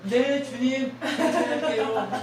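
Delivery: a loud, slow yes through a laugh. Shown as a demonstration. Preacher answers God's command to love, laughing and loud. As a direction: laugh as you promise obedience. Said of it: "answering God, laughing".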